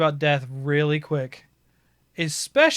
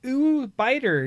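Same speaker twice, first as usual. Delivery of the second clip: put-on higher toddler coo, a lighter take; 8 semitones higher, slower than usual. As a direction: coo it like a curious baby.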